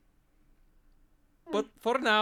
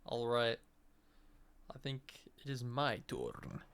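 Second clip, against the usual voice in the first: ordinary talk, nothing marked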